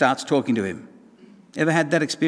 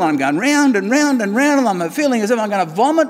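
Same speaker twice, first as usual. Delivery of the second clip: dizzy on the wheel; 7 semitones higher, 5 dB louder than usual. Acted. Cup spun on the wheel.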